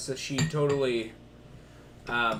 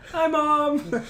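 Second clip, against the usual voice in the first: put-on much higher childish "Hi, Mom"; little kid voice; 12 semitones higher, 5 dB louder than usual. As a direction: squeak it like a small child. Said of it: "like a kid holding their parent's arm".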